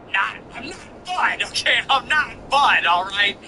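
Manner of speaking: high, slow put-on annoyed voice; mock irritated reply. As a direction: insist you're not Bud, mock-annoyed. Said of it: "I'm like".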